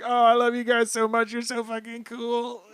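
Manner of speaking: high weepy gush; crying Frankie. Retold or imitated. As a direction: sob it out overjoyed. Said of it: "crying as well".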